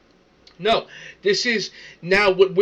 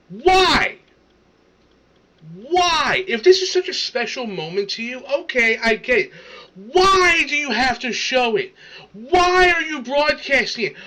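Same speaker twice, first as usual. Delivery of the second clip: raised, incredulous outburst on each why; exasperated rant; 4 semitones higher than usual. Real, not acted. Exasperated rant at crying video.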